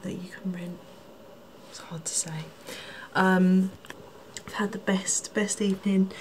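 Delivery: soft whisper late at night. Real, not acted. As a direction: whisper so you don't wake anyone. Quiet room at night, whispering.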